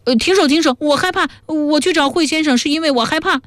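terrified pleading. A narrator's reading. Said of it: "他杀猪般的尖叫起来".

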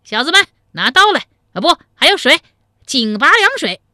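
high, loud call to the servants. A narrator's reading. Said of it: "将声音抬高了八度".

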